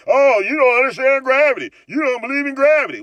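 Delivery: high, loud whiny mock voice; mocking globe believer.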